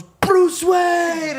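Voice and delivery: high, full mock shout; heckling shout. Imitating friend answering the questions wrong, heckling shout. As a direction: holler the wrong answer proudly.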